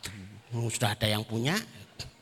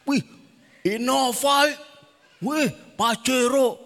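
loud, high-pitched wowing; exaggerated starstruck admiration; acted amazement; 11 semitones higher, 7 dB louder, slower than usual. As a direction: exclaim as if spotting a dream car. Acted wishing on seeing fancy cars.

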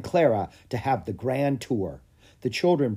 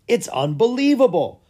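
much higher, louder astonishment; amazed exclamation; 11 semitones higher, 7 dB louder than usual. A narrator's reading. King seeing the hatchling, astonished exclaim. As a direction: say it in disbelief. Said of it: "said the king".

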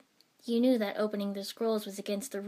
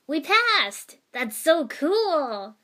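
loud, high, jubilant whoop; excited cheer; 7 semitones higher, 11 dB louder, much slower than usual. A narrator's reading. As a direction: shout it out, overjoyed, stretching the last words. Same boy now celebrates passing.

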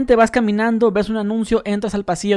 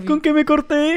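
high, drawn-out mock wail; slow, high, hammed-up alarm; 8 semitones higher, slower than usual. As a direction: playfully act out panicked bewilderment. Acts out her childish alarm.

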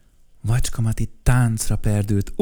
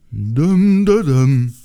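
creature voice; 4 semitones higher, 9 dB louder, much slower than usual. Acted. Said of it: "mondta ekkor Dömdödöm".

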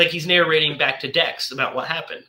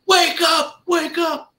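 high-pitched imitation of a wake-up yell; retold urgent holler, pitched way up; mock shout; 13 semitones higher, slower than usual. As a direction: call out as if rousing a sleeper, without full volume. Shouting to rouse the monster.

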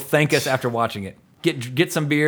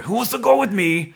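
quoting Van Damme's speech; 7 semitones higher than usual. Retold or imitated. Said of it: "I wanna give that speech".

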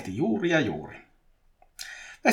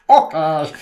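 loud, higher acted reply; vastaus; 4 semitones higher, 12 dB louder than usual. A narrator's reading. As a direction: blurt the answer out loudly. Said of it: "Petri vastasi". (kova, korkea vastaus)

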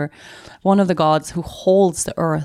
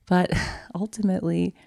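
ordinary delivery

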